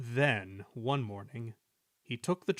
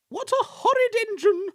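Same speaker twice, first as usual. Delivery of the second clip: very high, loud shriek; outraged squealing coaches; screaming; 9 dB louder than usual. A narrator's reading. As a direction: shriek it like an offended lady. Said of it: "screamed Agnes".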